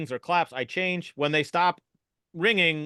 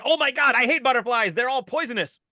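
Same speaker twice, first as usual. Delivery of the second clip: freaked-out superintendent; 9 semitones higher than usual. Acted. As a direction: shriek it like butterflies terrify you.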